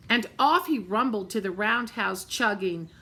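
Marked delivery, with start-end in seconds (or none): none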